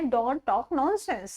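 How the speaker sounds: very slow, each word laid out for learners; slow teaching demo. Shown as a demonstration. Teacher dictating the English line to students, very slow. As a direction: spell it out slowly for the class. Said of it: "तब हम उस time पे बोल सकते हैं".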